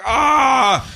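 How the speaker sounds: high, exasperated aah; exasperated cry. Acted. Reader infuriated by smug Sinestro, exasperated cry. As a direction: groan aloud in high-pitched frustration. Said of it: "you're like".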